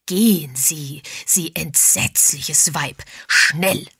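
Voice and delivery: emphatic, drawn-out indignation, ending loud; outraged lord. A narrator's reading. Lord sending the dreadful woman away, outraged. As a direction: banish them in appalled outrage.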